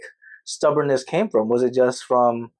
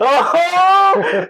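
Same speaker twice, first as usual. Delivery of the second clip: excited cry; 5 dB louder than usual. Real, not acted. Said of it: "reacting to good news".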